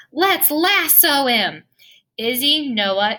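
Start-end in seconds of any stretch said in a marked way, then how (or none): 0.1-1.6 eager shout. Acted. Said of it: "Noah shouts".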